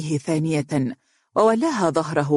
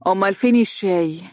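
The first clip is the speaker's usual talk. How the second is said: higher, condescending demand; lofty; 4 semitones higher than usual. A narrator's reading. Donia surveying the table, lofty. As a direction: ask as if above everyone. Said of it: "وقالت بترفع".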